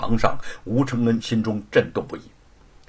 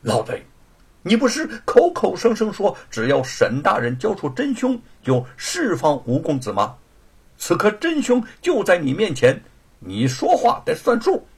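icy anger; 7 semitones higher, 4 dB louder than usual. A narrator's reading. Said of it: "立时就凝入寒霜".

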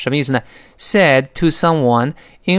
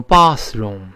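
British "bathroom" with long ah; British pronunciation; 3 semitones lower than usual. Shown as a demonstration. Teacher shows how Britons say bathroom, British accent. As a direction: open "bath" to a broad British ah. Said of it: "英国人呢".

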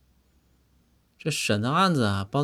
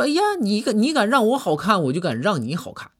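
mock defiant retort; 7 semitones higher, 3 dB louder than usual. Acted quarrel, retort.

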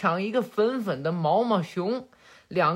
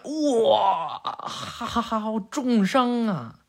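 hammed-up wail of pain; 3 semitones higher, much slower than usual. Father jabbed in the belly by daughter, mock howling in pain. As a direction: howl comically, dragging out the words. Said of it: "鸣人疼的呀".

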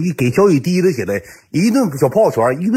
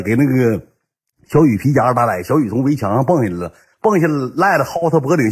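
usual speaking voice

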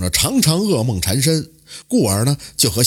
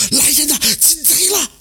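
much higher, shrieking for help in fright; panicked shriek. A narrator's reading. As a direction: scream for the guards, terrified. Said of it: "吓得失声尖叫".

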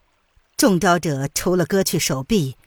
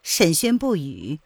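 ordinary delivery

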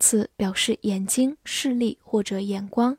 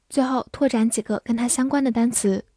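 normal talking voice

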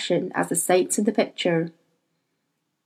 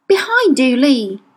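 high, loud sudden warning cry; urgent warning; 5 semitones higher, 5 dB louder than usual. Acted. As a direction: shout the warning as the daemon Hester. Hester warns Lee of attacker.

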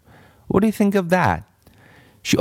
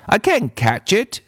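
put-on high, insistent little-girl voice; a stubborn girl contradicting her teacher; bratty child insisting she's right; contrary girl; 9 semitones higher than usual.

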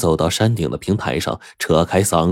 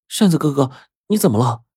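frightened; 8 semitones higher, faster than usual. Acted. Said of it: "小梅被扇子的举动给吓坏了".

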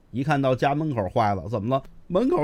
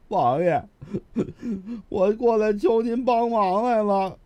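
high, sobbing plea, voice breaking; acted crying; 7 semitones higher than usual. A narrator's reading. Kneeling scholar begs the prince for help, crying. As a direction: beg through put-on tears. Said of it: "说着就哭了".

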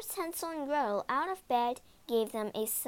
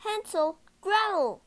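high, louder calling of names; surprised call; 7 semitones higher, 5 dB louder than usual. A narrator's reading. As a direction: call out in astonishment. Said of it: "called the woodcutter in surprise".

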